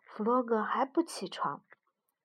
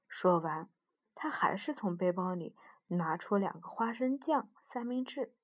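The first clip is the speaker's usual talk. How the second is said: plain reading voice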